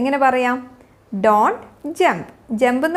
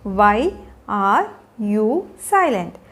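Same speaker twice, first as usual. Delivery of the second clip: slow and low, words spaced out; 5 semitones lower, much slower than usual. Shown as a demonstration. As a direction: pause clearly after every word. Teacher models question slowly, then joined.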